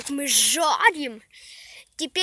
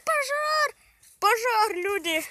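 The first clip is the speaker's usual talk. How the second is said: panicked shout; 7 semitones higher, slower than usual. Real, not acted. Fire breaks out, kids panic.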